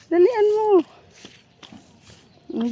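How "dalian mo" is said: calling out. Real, not acted. Calling to hurry child.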